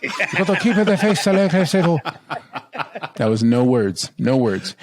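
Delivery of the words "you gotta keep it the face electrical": put-on high, garbled Spanish-accented announcer; put-on Latin presenter accent. Put-on Telemundo host gibberish.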